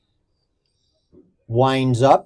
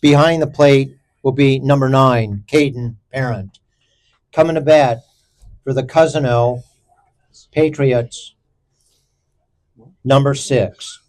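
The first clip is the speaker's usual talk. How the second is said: lineup announcer; 3 semitones lower than usual. Acted. Announces starting lineup formally.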